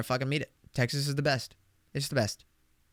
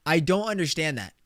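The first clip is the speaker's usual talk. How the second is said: ordinary delivery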